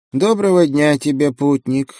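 lightly creaky little monk; a thin creaky greeting. A narrator's reading. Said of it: "скрипучим голосом поприветствовал он меня".

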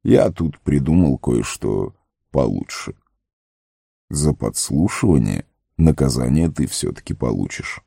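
low, measured, businesslike wizard; businesslike Gandalf. A narrator's reading. Gandalf hands Sam his punishment for eavesdropping, low and businesslike. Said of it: "деловито сказал ему Гэндальф".

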